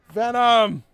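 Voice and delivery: loud mock-scolding call of a name; scolding shout. Real, not acted. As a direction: call him out, laughing but stern. Scolding friend for rude joke.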